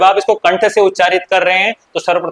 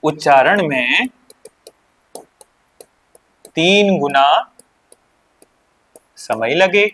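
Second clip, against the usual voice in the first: slow dictation; slower than usual. Shown as a demonstration. A teacher dictating to pupils, slow and deliberate. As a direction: spell it out slowly, word by word. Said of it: "dictating slowly".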